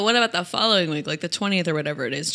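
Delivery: fast, rushed question. Retold or imitated. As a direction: blurt it out quickly. Retold rushed question at salon.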